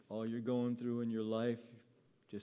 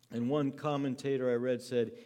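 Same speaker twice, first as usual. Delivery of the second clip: ordinary talk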